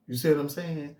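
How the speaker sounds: ordinary talk, nothing marked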